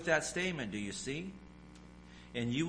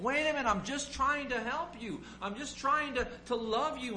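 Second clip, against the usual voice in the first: high-pitched plea to be understood; pleading; 11 semitones higher than usual. Retold or imitated. Believer pleading with someone who hates them, high and earnest. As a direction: beg them to see you mean well. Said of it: "you're wrestling with the fact of".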